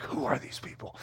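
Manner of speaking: hushed, voiceless whisper. Retold or imitated. Natives hiding under the trees wonder about settlers, whispered. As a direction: breathe it like someone hiding.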